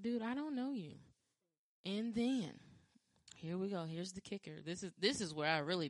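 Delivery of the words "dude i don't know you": higher, exasperated dismissal; incredulous brush-off. Retold or imitated. Telling the would-be husband off, higher and incredulous. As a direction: shut him down, disbelieving. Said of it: "I'm like".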